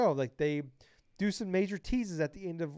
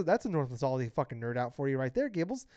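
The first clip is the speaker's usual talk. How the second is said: ordinary talk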